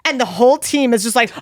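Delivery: normal talking voice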